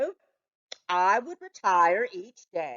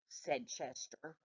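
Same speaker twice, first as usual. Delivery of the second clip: usual speaking voice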